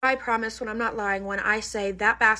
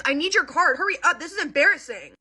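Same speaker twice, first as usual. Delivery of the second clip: high, rude, bossy demand; rudest tone; 7 semitones higher, 3 dB louder than usual. Retold or imitated. Spoiled girl rushing her mom on the phone, high rude whine. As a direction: snap at mom like a spoiled brat. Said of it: "In, like, the rudest tone ever".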